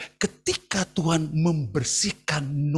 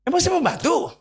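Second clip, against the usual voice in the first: higher, cheeky talk-back; sassy teen retort; 9 semitones higher than usual. Retold or imitated. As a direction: mouth off at mom. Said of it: "Emaknya bilang gini". (anak menjawab ibunya dengan ketus)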